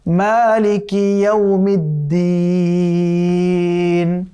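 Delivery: slow, drawn-out melodic tajweed chant; chanted Quranic recitation. Shown as a demonstration. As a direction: recite the verse again slowly, melodic and measured. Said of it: "এটিও পড়া যাবে".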